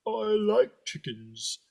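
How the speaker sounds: put-on high, slow childlike plea; simple-minded devil.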